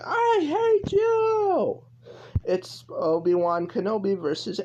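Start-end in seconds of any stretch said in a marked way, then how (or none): 0.0-1.8 high, drawn-out anguished-style yell; mock shouting. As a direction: stretch it into a long, much higher shout. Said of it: "It's time to start shouting".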